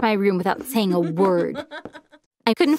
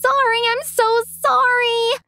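much higher, strained, tearful pleading; hysterical sobbing; 13 semitones higher than usual. Acted. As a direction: sob out the apology as if breaking down. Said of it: "She was sobbing hysterically".

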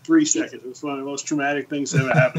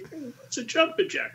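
high confused voice; 11 semitones higher, 6 dB quieter than usual. Retold or imitated. Retelling a bleeding recruit's confusion.

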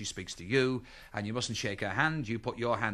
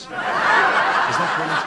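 wheezing laugh, much louder, slowed; 2 semitones higher, 12 dB louder, much slower than usual. Real, not acted. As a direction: gasp it out through laughter. Laughing after punchline.

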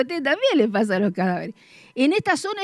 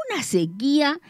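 ordinary talk, nothing marked

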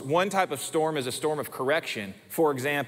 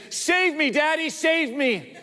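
child's mock plea; 12 semitones higher, slower than usual. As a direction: beg like a scared little girl, slowly. Daughter pleading over spider.

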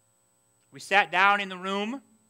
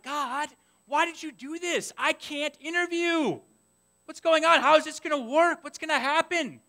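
whining to God; 9 semitones higher than usual. Retold or imitated. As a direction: moan your questions up at God. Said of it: "I was like".

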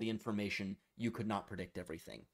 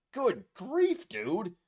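high, louder dismayed boss reaction; mock-appalled exclamation, pitched up; exasperated boss; 10 semitones higher, 7 dB louder, much slower than usual. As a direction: imitate a boss appalled at what he just heard. Boss reacting to loud fart, retold.

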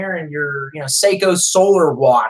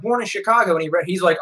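ordinary talk, nothing marked

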